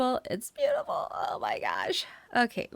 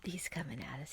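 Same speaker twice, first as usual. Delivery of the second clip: whispering; 5 semitones lower, 13 dB quieter than usual. Real, not acted. Enemy approaching, tense.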